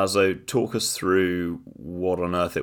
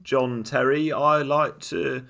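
higher-pitched mock British accent; bad British accent; 6 semitones higher, 3 dB louder, slower than usual. Co-host copying the TikTok bit, mock British accent. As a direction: put on a clumsy British accent, dragging the end. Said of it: "American person trying to do British accent".

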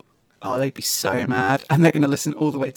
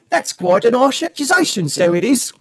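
hammed-up mock cockney accent, pitched higher; 7 semitones higher, 4 dB louder than usual. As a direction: play a Mary Poppins chimney sweep in a cockney accent. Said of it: "I can't do this for very long".

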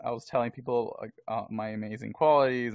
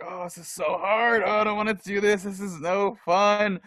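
whiny inner voice; 9 semitones higher, 7 dB louder than usual. Retold or imitated. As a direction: moan like a tired runner's brain. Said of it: "My brain's just like".

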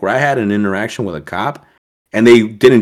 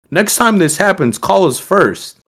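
higher, measured voice of a cop; slow down at the end, imitating official advice; imitated police chief; 9 semitones higher, 4 dB louder, slower than usual. Police chief advising the driver, imitated official voice.

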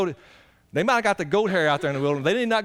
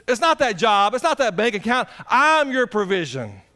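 rising, louder preacher's build; full-force preaching; 8 semitones higher, 4 dB louder than usual. Acted. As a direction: drive it home to the congregation.

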